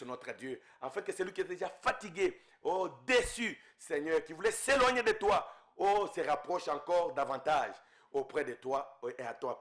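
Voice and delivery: ordinary delivery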